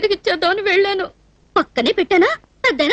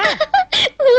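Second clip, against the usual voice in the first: high-pitched giggle; 8 semitones higher than usual. Acted. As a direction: burst into a short, high giggle. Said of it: "ఏమిటా నవ్వు".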